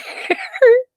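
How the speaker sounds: sudden high-pitched giggle fit. Real, not acted. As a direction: break into giggles mid-sentence. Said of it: "Okay, I'm done".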